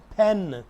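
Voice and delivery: "pen" with a puff of air on the p; aspirated p. Shown as a demonstration. Teacher modelling "pen" for students, p clearly aspirated. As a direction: release the p of "pen" with extra breath. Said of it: "प की बजाय इसको फ आपने पढ़ना है".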